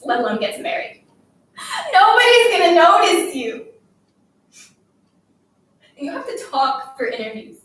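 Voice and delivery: loud, overwrought tirade; tearful scolding. Acted. Girl haranguing her silent classmate while crying, tearful scolding. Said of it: "I couldn't stop crying and talking at the same time".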